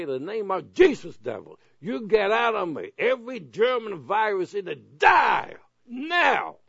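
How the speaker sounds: rising to a high, loud shout at the devil; shouting down the devil, getting angry; angry rebuke. Shown as a demonstration. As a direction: command the devil out, building to a furious shout. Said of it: "you gotta get a little bit angry".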